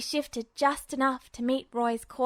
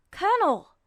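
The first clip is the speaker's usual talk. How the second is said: a burst of discovery, higher and louder; sudden exclamation; 7 semitones higher, 5 dB louder than usual. A narrator's reading. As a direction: blurt it out high and loud in sudden realization. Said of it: "he exclaimed".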